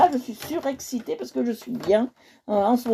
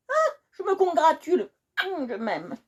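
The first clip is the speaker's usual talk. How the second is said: mock smug; 6 semitones higher than usual. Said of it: "Non, je plaisante".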